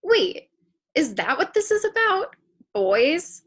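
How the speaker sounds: indignant surprise. Acted. Teen reacting to her future self's warning about boys. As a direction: jump up in pitch, taken aback.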